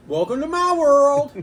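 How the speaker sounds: loud, high, drawn-out announcement; retold showy reply. Shop owner answers amazed customers, showy and loud. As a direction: stretch it out like a big flourish. Acts his boastful reply to them.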